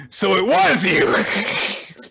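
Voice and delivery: put-on high, drawn-out childish outburst; mock accusation. Child turning on the dog, mock-angry accusation. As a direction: accuse like a furious small kid.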